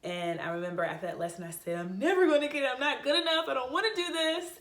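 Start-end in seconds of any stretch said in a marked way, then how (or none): 1.8-4.5 high, loud, teary wail of giving up; tearful despair. Retold or imitated. Student after first lesson to her friends, tearful wail. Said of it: "I was just a ball of tears".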